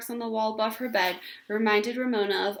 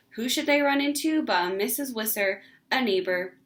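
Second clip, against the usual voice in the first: usual speaking voice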